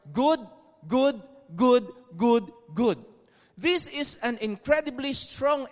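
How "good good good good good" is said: each "good" a separate beat, pushed louder; "good" repeated like hammer strokes; emphatic repetition. Shown as a demonstration. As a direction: drive home every "good" on its own. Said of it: "it opens with those words".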